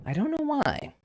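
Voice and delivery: very high, electronically shifted voice; high-pitched mic distortion. Real, not acted. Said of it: "a little high-pitched".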